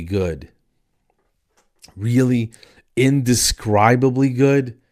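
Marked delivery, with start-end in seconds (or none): 1.9-4.8 louder, drawn-out disbelieving echo; incredulous questioning. Acted. Customer doubts the chicken place's motto, incredulous.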